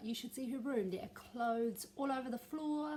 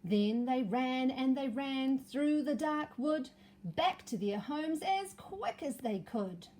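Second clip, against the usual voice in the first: story narration; 2 semitones higher, 4 dB louder than usual. A narrator's reading. Story finale for children.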